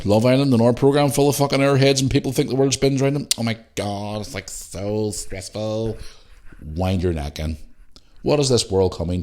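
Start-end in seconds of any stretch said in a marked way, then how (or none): 3.4-6.0 slow, soft airhead imitation; lightly mock a self-absorbed reality-show type. Mimics airheads on Love Island.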